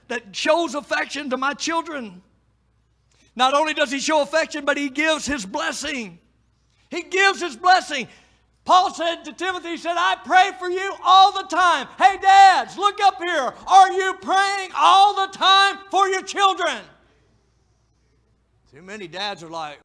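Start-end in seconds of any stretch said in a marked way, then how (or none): 3.3-6.2 loud, high preacher's cry; fiery preaching. Acted. Preaching climax. 6.9-8.1 shouted, high and loud repeat; shouted pulpit repetition; preaching shout. Acted. 8.6-16.8 high, loud pulpit shout; preacher shouting at the fathers. Acted.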